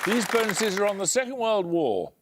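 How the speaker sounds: ordinary talk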